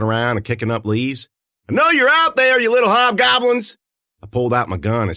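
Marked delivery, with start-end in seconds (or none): 1.7-3.8 high, loud taunting yell; calling into the trees; shouting. A narrator's reading. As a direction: challenge the hidden things out loud. Shouting into the woods at creatures.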